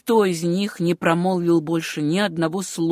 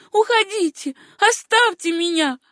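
high, loud desperate begging; pleading cry; 9 semitones higher, 4 dB louder than usual. A narrator's reading. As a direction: push him away, begging. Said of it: "умоляющим голосом промолвила девушка". (высокий умоляющий крик)

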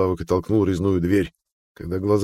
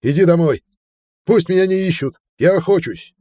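shouting through wind; 11 semitones higher, 6 dB louder than usual. A narrator's reading. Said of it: "прокричал сквозь ветер".

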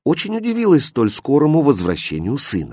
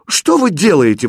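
higher, raised-voice indignant challenge; indignant cry; 6 semitones higher, 4 dB louder than usual. A narrator's reading. Aladdin to boys tormenting a puppy, indignant cry. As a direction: call out in mild outrage. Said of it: "возмущённо закричал Аладдин".

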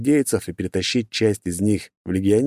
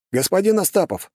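calling out; 12 semitones higher than usual. Acted. Andrey calls over intercom.

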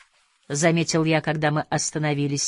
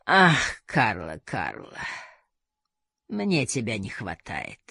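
slow, sighing lament sinking very low; Madame Zhou; 7 semitones lower, 3 dB quieter, slower than usual. A narrator's reading. Hidden woman tells Karla she misses her, low sighing voice. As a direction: let each phrase drop low and soft. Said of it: "Её голос обдирал кожу, как тёрка".

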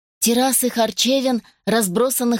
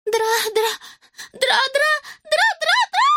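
very high rising stammer; terrified stammer; slower than usual. Acted. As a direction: stutter in rising terror. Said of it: "пробормотала девочка".

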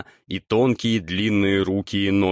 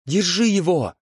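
urgent shout; 10 semitones higher than usual. A narrator's reading. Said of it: "раздался голос Тук".

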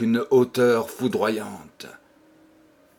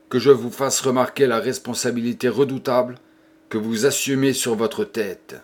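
usual speaking voice

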